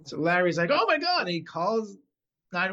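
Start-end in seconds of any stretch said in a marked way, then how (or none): 0.7-1.2 high, loud retold exclamation; mock alarm. Larry finding a cyclist bleeding, mock alarm. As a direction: imitate a startled bystander raising the alarm.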